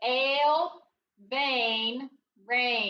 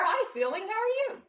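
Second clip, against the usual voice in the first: playful greeting; 8 semitones higher, faster than usual. Pretend.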